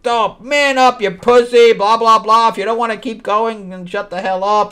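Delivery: high-pitched, hammed-up berating; shrieky parody of bossy impatience; mock scolding woman. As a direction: imitate her telling them all off. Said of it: "and she's like".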